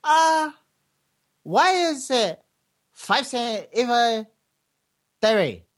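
high, exasperated mock Chinese accent; hammed-up Chinese customer accent, baffled. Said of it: "And the Chinese goes".

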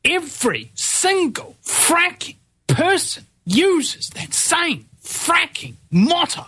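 exasperated, high-pitched, each word spaced out; ranting, voice pitched right up; worked-up rant. Real, not acted. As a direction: hammer every word in high, slow exasperation. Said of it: "I'm not gonna be calm".

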